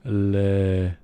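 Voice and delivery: loud, slow put-on French gibberish; mock French. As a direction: bluff through schoolboy French. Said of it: "Okay, I'm gonna try".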